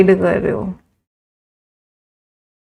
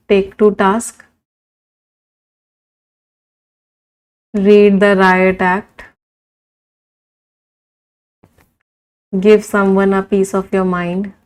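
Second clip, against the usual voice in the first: very slow, word by word dictation; much slower than usual. Shown as a demonstration. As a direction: spell it out slowly for the class to write. Teacher dictates idioms slowly. (धीरे धीरे लिखवाते हुए)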